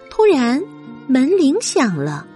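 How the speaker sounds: ordinary delivery, nothing marked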